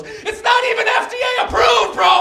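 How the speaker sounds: very high, slow shouted outrage; excited shout. Retold or imitated. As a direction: yell it running up like a fired-up bro. Random dude runs up shouting.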